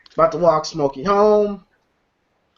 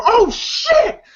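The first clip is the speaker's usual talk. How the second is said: high alarmed cry; sudden high "oh shit"; startled. Retold or imitated. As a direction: yelp it as the van roars up. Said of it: "Smokey and Craig go".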